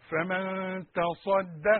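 "فمن تصدى" drawn out, the ص thick and the د clear; very slow careful recitation. Shown as a demonstration. Teacher modelling the verse slowly for students. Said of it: "الدال جاية بين الصاد التخينة والقاف".

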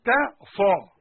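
"تا" smiled then "صا" with lips pushed forward; pursed vs smile. Shown as a demonstration. Teacher contrasting smiled ta and rounded sa, loud. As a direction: move from smile to pout between "تا" and "صا". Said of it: "تبويزة بقى أو قبلة".

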